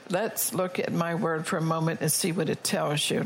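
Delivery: usual speaking voice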